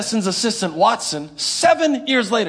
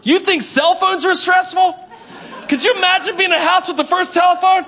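excited laugh; 8 semitones higher, 3 dB louder than usual. Real, not acted. Laughing excited rhetorical question.

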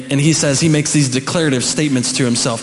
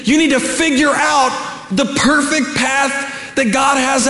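raised-pitch, driving sermon delivery; impassioned preaching; 7 semitones higher than usual. Real, not acted. Preacher urging congregation to find God's will, impassioned preaching. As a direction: preach it up high, insistent.

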